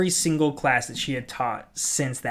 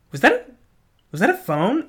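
higher, louder, mock-surprised question; imitating instructor; 9 semitones higher, 5 dB louder than usual. Instructor asking the class about the phone, mock surprise.

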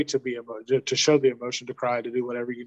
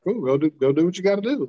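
higher, lightly voiced easygoing go-ahead; parent giving permission; 5 semitones higher than usual. Parent to older son who asks for time to cry, easygoing. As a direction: give the kid an easy yes.

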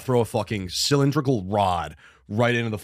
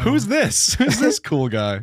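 cowardly lion voice; 9 semitones higher, much slower than usual. Acted.

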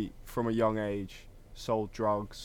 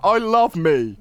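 higher, louder hammed-up boast; mock vain friend; 8 semitones higher, 11 dB louder, slower than usual. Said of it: "And he's like".

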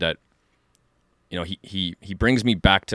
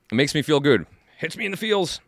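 normal talking voice

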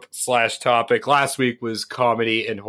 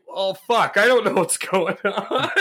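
higher-pitched mock panic, breaking into laughter; retold panic; 6 semitones higher, slower than usual. Host recalling when the audio cuts out, mock panic. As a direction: act out your own flustered helplessness, amused. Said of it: "I'm like".

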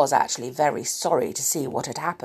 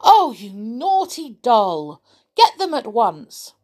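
higher, louder scolding; 5 semitones higher, 5 dB louder than usual. Acted. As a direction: tell off the naughty doll. Toys scolding Amelia.

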